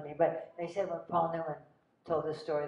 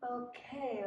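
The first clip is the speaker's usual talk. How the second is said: dazed; 8 semitones higher, 6 dB quieter than usual. Retold or imitated. Acting her stunned reply to star.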